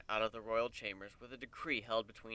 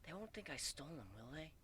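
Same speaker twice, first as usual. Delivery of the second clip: soft, higher, worried; anxious mare whispering; 5 semitones higher, 13 dB quieter than usual. A narrator's reading. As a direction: fret quietly. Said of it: "looking worriedly left and right".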